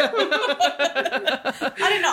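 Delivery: stretched out, laughing through it. Real, not acted. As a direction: giggle the words out slowly. Laughing while answering.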